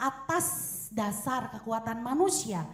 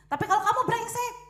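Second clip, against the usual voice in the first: mock insult; 9 semitones higher, 4 dB louder, faster than usual.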